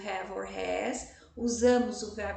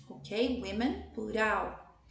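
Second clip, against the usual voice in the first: ordinary talk, nothing marked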